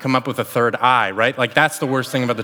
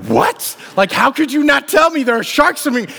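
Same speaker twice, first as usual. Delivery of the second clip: high-pitched mock panicked outburst; alarmed disbelief; 11 semitones higher, 3 dB louder than usual. Wife confronting husband about sharks, retold as panicked disbelief. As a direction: imitate an appalled, panicking reaction. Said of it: "And she looks at me like".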